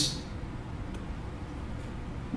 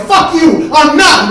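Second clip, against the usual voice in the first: high, screamed comeback; furious refusal; 9 semitones higher, 11 dB louder, slower than usual. Retold or imitated. Mike refusing his brother a ride, screaming back furiously. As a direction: yell back at full volume.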